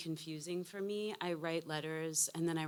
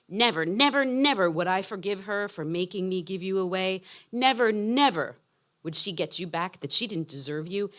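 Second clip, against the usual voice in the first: louder, higher, insistent never, never; retold angry vow; 3 semitones higher, 14 dB louder than usual. Mother recalling her vow to daughter, mock fierce insistence. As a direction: hammer the nevers as she recalls the fight.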